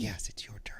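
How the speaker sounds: soft hushed whisper. Real, not acted. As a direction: whisper so the audience won't hear. Whispered asides as show cues.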